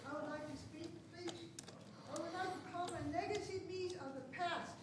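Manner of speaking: calling out from the back of the room; far from the microphone. Real, not acted. Woman calls out to the board from far off the mic. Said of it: "We can't hear you very well".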